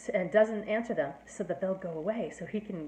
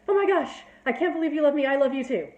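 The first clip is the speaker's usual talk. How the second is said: much higher and louder, gushing with joy; overjoyed Romeo; 7 semitones higher, 8 dB louder, faster than usual. Retold or imitated. Romeo revealing himself to Juliet, gushing excitedly. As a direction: burst out thrilled he's loved back.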